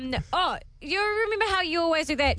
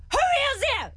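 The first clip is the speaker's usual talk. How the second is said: high mock-tough challenge; trying to sound tough; 11 semitones higher than usual. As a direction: re-enact confronting a burglar in a squeaky voice. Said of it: "I try to sound real tough".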